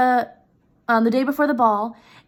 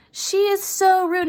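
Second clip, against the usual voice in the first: higher and slow, dragging out the outrage; indignant; 8 semitones higher, slower than usual. Real, not acted. Teacher scolding the wife for rudeness, slow and indignant. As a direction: lean into how rude she is.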